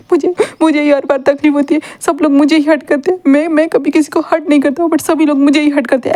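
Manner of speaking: mild mock sobbing complaint; loud crying. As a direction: wail it out like a hurt boy, only half-sobbing. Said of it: "ऐसे ही wing ज़ोर-ज़ोर से कहते जा रहा था".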